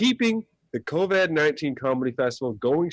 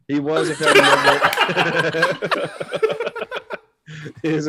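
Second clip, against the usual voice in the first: laughing; 5 semitones higher, 6 dB louder, slower than usual. Real, not acted. Host cracks up mid-intro.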